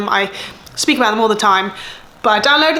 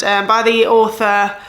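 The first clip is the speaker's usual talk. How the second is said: ordinary talk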